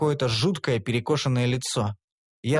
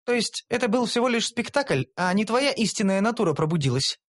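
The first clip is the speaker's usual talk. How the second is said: higher, questioning voice; lightly voiced elf; 7 semitones higher than usual. A narrator's reading. Elf asking if it was an act, doubtful. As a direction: check it doubtfully. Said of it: "уточнила она".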